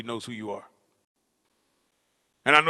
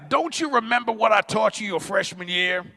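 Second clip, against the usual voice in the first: high, raised coach voice; coach questioning his player; 8 semitones higher, 4 dB louder than usual. Retold or imitated. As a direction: challenge the player with a pitched-up question.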